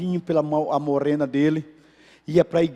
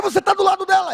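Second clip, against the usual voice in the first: much higher, sharp accusing reply; wife accusing her husband, high and indignant; high indignant retort; 3 dB louder, much faster than usual. Retold or imitated. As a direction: throw the accusation back at him, pitched way up. Wife's heated retort acted out.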